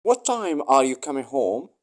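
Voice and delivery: ordinary talk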